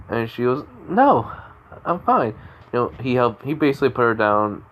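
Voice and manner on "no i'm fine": put-on higher-pitched voice for the girl; acted girl character. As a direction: lightly voice a girl brushing off concern. Acting girl reassuring her rescuer.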